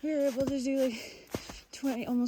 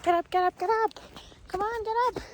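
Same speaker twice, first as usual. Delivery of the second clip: much higher, loud, bouncy wake-up call; eager self-rallying; 12 semitones higher, 5 dB louder, faster than usual. Retold or imitated. Hiker recalls pumping herself up in the desert, high and loud. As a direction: chant it like a cheerleader rousing herself. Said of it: "I was like".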